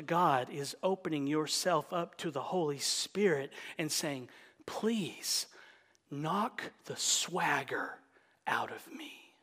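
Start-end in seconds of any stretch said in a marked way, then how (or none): 4.7-9.3 soft whispered plea; hushed whispered plea to God; hushed prayer. Pretend. Acted prayer inside sermon.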